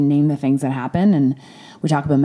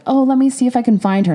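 much higher, brighter voice imitating a polite receptionist; mock hospital staff; 9 semitones higher, 4 dB louder than usual. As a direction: chirp it like an overly polite clerk. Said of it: "they're like".

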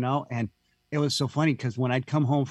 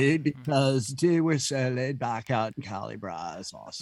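laid-back Cali surfer. Pretend. Host mimicking West Coast mentality, light surfer dude. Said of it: "there's a mentality".

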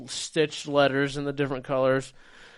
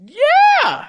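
yelled high and loud; excited yes; 12 dB louder than usual. Real, not acted. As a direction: blurt it out in excitement. Confirming the shocking detail.

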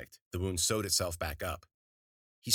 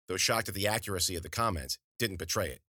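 ordinary delivery, nothing marked

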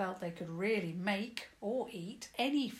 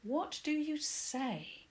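stern; 9 semitones higher, slower than usual. Acted. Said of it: "said sternly".

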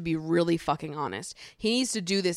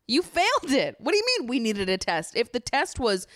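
higher-pitched, exasperated callout; incredulous objection; 5 semitones higher than usual. Acted. Viewer scolding contestants over their so-called test, incredulous. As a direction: throw it back at them in disbelief. Arguing back at the contestants' logic.

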